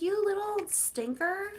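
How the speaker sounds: high playful scolding of a cat; high fond teasing of a naughty kitten; teasing pet talk. Retold or imitated. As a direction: tease the cat in a high sing-song. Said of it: "I'm like".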